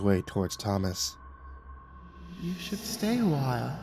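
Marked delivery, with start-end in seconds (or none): 2.4-3.8 put-on higher, soft sly child voice; soft high creepy boy; giggling dead boy. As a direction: invite him in like a creepy boy. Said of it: "he giggled".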